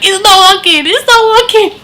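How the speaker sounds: high, loud, frantic protest; desperate yell. Retold or imitated. Labouring mother rejecting the laughing gas from her midwife, high desperate yell. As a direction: fling the words like the gas mask. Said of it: "you need to see how I threw this thing at her".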